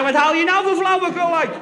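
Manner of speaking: the soldier mimicking the general, loudly; loud mock roar. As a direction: throw the general's shout back at him. Speaker echoes the general louder.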